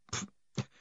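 spitting out cloth. A narrator's reading.

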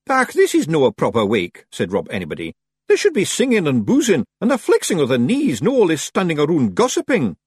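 high Scots Feagle grumble; high-pitched Scottish character voice; complaining Feagle leader. A narrator's reading.

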